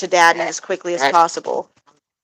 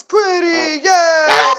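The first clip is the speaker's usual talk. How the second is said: high, loud cheering call; imitating a cheer; 9 semitones higher, 5 dB louder, much slower than usual. Husband cheering the parrot, high loud mock cheer. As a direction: shout it up high like a happy owner. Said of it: "when my husband goes".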